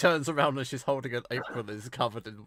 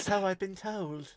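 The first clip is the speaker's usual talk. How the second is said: slow, bratty retake, higher; 8 semitones higher, 6 dB quieter, slower than usual. Acted. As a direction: say it like a spoilt little brat. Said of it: "Sounds a bit bratty".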